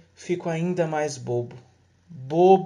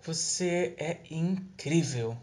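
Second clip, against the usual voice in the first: tender lovers; 3 semitones higher, slower than usual. Acted. Lovers whisper sweet nothings.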